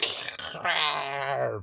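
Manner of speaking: long imitated creature screech; monster hatchling screech. Speaker mimics a creature hatching out of the planet, mock screech. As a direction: screech like something bursting from an egg.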